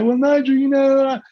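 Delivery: high, loud whiny excuse; hesitant angel. Retold or imitated. Speaker mimics an angel making excuses to Nigel, high and loud. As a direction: hem and haw like you're not ready. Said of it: "and they".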